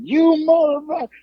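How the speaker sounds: high-pitched mock yell of fury; hammed-up imitation of a livid wrestler yelling; imitating a screaming rage. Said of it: "just screaming at us".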